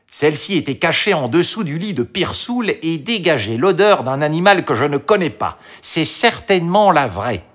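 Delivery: confident fox. A narrator's reading. The fox hands Marie the horn, confident. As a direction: announce the find in a high voice.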